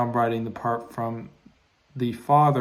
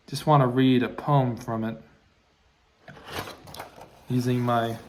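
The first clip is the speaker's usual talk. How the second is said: ordinary talk, nothing marked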